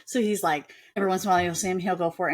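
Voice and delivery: ordinary talk, nothing marked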